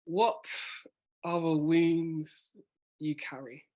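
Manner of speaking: very slow, a pause after each word group; slow with long pauses. Shown as a demonstration. Coach demonstrates a slow, paused reading to the poet. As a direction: let the question hang word by word. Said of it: "you know, maybe".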